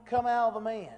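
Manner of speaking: loud, forceful order; acted command. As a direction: call it out with preacher's authority. Acting out Jesus commanding the demon.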